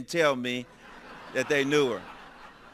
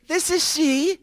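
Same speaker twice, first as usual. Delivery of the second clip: her voice on the phone; 13 semitones higher, 3 dB louder, slower than usual. Retold or imitated. Woman answers the phone, surprising reveal.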